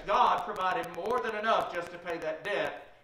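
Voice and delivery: usual speaking voice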